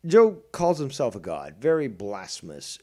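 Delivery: usual speaking voice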